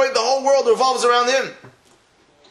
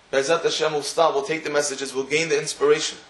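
sermon blessing; 6 semitones lower, 3 dB quieter than usual. Real, not acted. Closing blessing, lower and softer.

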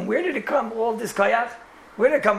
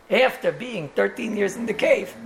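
ordinary delivery

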